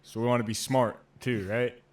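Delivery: imitated drill instructor, loud challenge; mock threat with a loud questioning tag. Drill instructor rounding on the smart recruits, loud mock menace. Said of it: "the drill instructor's like".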